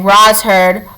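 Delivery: ordinary delivery, nothing marked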